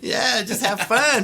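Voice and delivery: laughing, loud and slow; real laughter through the words. Real, not acted. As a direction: laugh it out, carefree.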